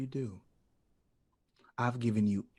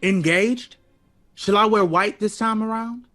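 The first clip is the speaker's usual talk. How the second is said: sarcastic disbelief; 12 semitones higher, 6 dB louder than usual. Acted. Sarcastic comeback to Ellington's request.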